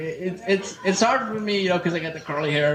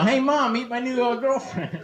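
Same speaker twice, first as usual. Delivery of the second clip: sheepish son; 7 semitones higher than usual. Acted. Introducing girlfriend to mom.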